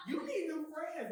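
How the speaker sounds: high, slow, laughing; laughing mock advice. Telling doubters they need new friends, laughing. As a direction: tease them through a laugh. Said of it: "I'm like".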